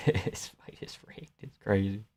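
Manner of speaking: whispered aside. Real, not acted. Streamer muttering to chat about a rigged fight, whispered. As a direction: breathe it out without voice.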